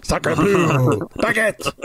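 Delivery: hammed-up French accent; slow, mock French words. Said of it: "I'm French now".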